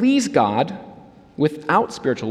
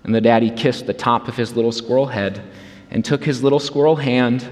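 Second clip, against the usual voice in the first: ordinary delivery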